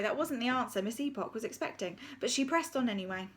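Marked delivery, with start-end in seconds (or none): none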